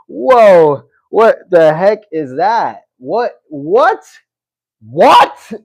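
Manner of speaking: loud, high, repeated incredulous what; real loud shock; stunned disbelief. Real, not acted. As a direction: shout out disbelief at the comment. Reacting to shocking comment.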